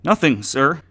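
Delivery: high, loud and hurried; quick reply. Acted. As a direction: blurt it out fast to the boss. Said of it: "Andy quickly replied".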